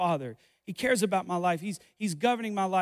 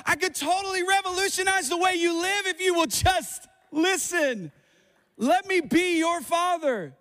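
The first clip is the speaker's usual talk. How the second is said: put-on raised voice, urging his children to listen; God pleads with his children to just listen, raised; God pleading as father; 8 semitones higher than usual. God voiced pleading with listeners, raised fervent voice. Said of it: "He said".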